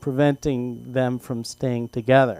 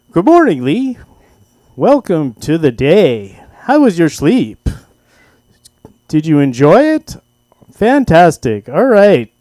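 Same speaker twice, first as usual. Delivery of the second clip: dad's radio announcer voice; 3 semitones higher, 9 dB louder than usual. Retold or imitated. Dad waking his son with a mock radio broadcast, loud announcer voice. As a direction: announce it like a breakfast DJ into a mic. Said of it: "he would practice his voice, his broadcasting".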